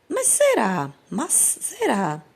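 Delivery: lightly acted high-pitched doubt; doubting comic character. Teacher reading a comic strip to pupils, mildly acted doubt.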